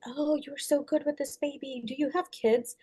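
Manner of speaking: a soft, admiring mother's voice; quoting the mom. Retold or imitated. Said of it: "the mom asked me".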